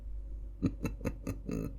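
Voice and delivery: deep, quiet sinister chuckle; low villain laugh, savoring revenge; soft, slow evil chortle; demonic chuckle. Acted.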